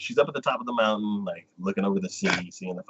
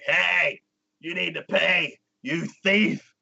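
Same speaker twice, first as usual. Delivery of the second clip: higher, scolding creature voice; high mock-scolding bus creature; mock creature driver; 7 semitones higher, slower than usual. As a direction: scold the passenger as a pushy creature.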